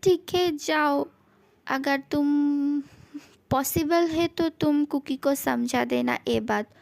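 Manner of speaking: normal talking voice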